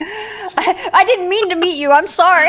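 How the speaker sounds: high and loud through giggles; laughing. Real, not acted. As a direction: squeal it out while laughing. Laughing high mock apology.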